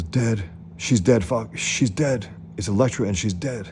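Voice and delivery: hammed-up grief, repeating in disbelief; distraught outcry. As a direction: blurt it out in mock desperation. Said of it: "he's screaming out".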